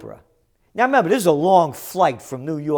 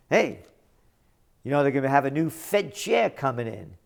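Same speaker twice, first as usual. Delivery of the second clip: ordinary talk, nothing marked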